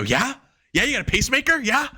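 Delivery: high, rising mock taunt. [name] taunting Paul about his pacemaker, high and mocking. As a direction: needle him in a squeaky high voice.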